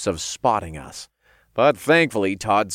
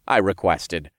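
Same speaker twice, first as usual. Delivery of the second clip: ordinary delivery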